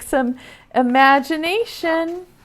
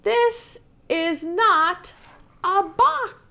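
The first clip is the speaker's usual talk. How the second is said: voice 6; 9 semitones higher, slower than usual. A narrator's reading. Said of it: "And the bunny says".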